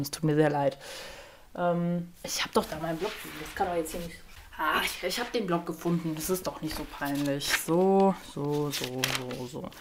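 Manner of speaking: soft, sheepish muttering while searching; quietly fumbling, talking to herself; embarrassed mutter. Real, not acted.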